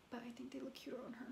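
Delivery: quick, confiding whisper; whispered aside. Real, not acted. Whispering to viewers about a coworker's glasses, confiding aside. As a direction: whisper it fast, as a secret opinion.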